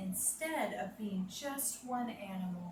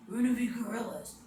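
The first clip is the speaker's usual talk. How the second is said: fast and louder, excited call; loud announcement; 5 dB louder, faster than usual. Shown as a demonstration. Teacher calling out the gorilla pose to the kids. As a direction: burst out with the news. Same line whispered then loud.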